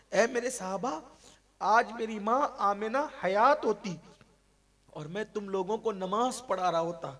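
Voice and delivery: a preacher crying out the Prophet's words; impassioned preaching. Acted. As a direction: pour longing into it. Voicing the Prophet's longing.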